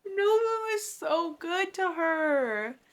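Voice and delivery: high, drawn-out gush; slow, touched swoon over a sweet character. Real, not acted. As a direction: melt with affection, stretching the words. Gushing over a tender scene.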